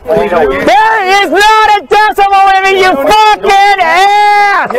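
high angry yelling with curses; furious shouting. Real, not acted. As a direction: scream the insults at him. Shouting insults at the officer.

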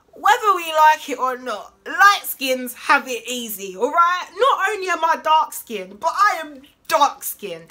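proclaiming it to her viewers with real heat; fired-up declaration. Real, not acted. Dark-skinned vlogger speaking out to viewers, loud and passionate. Passionate rant about skin colour.

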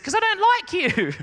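put-on high little-kid retort; a tiny twelve-year-old sulking; high, childish comeback; squeaky kid voice. Kid's squeaky reply.

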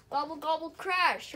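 loud refrain. A narrator's reading. Refrain read with gusto.

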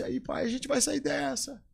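a light, slow, falsely hopeful hospital voice; mock pitying visitor. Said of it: "Eu não perguntava assim". (imitação leve de visita com pena)

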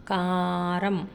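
కారం with the ఆ held very long; కారం, first vowel slowly lengthened; long vowel stretched. Shown as a demonstration. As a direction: drag out the ఆ in కారం. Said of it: "దీర్ఘం ఉన్న చోట మనము సాగదీసి పలకాలి".

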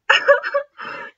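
high loud mean cackle; mocking laugh. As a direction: laugh in their face.

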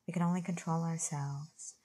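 ordinary talk, nothing marked

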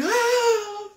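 high crying gasp of awe; high mock sob of awe; mock awed gasp. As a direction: gasp like someone deeply moved. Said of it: "Y usted".

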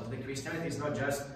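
normal talking voice

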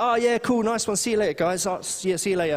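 mock-nonchalant Jesus faking leaving; fake casual goodbye. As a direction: pretend you're heading off, all casual. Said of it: "He's like".

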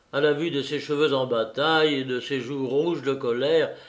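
ordinary delivery, nothing marked

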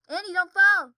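high, very loud mock yell; imitated warning shout. Showing how she said it, imitated warning shout. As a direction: belt out an imitated panicked warning. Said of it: "the way she said".

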